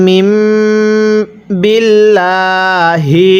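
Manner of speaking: বিল্লাহি stretched very slowly, rising at the end; drawn-out recitation. Shown as a demonstration. A teacher models the word for students, slow chanted recitation. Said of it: "কিভাবে পড়ব?".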